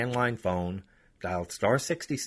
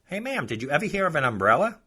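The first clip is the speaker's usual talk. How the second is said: higher, louder sarcastic question; mock-polite sarcasm; 7 semitones higher, 5 dB louder than usual. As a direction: needle her with fake politeness. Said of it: "sarcastically say".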